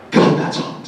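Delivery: whispered, lustful Shatner; a half-whispered mock leer; breathy Shatner. Shatner picturing his neighbor among groupies, whispered and leering. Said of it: "he just goes".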